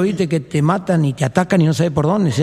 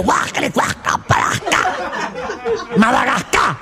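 retching disgust; 10 semitones higher, slower than usual. Acted.

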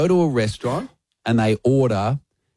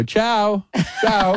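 high, sing-out Italian "ciao"; mock a pretentious Italian goodbye, high pitched; affected Italian farewell; 9 semitones higher than usual. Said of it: "you just throw in a foreign word".

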